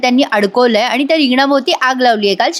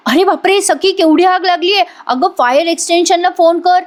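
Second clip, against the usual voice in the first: much higher, hammed-up alarm; fake panic; 7 semitones higher than usual. [name] mimics Priya's fake drama, high mock panic. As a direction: pretend to panic, mocking her. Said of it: "तसंच नाटक करतात".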